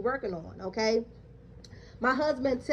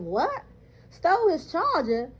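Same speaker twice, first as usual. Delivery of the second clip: incredulous; 4 semitones higher than usual. Retold or imitated. To the supervisor, incredulous and raised. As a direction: repeat the accusation in disbelief. Accused of stealing a charger.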